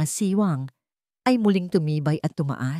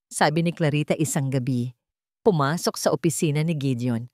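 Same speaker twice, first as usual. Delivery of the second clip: usual speaking voice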